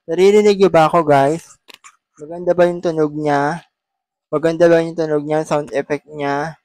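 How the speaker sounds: loud call from across the room; calling from afar. Real, not acted. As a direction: project your voice to a distant mic. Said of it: "kahit malayo daw".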